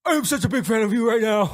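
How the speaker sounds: high, gushing fan voice. Retold or imitated. Comic telling of meeting a celebrity, gushing. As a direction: blurt it out high like a starstruck fan.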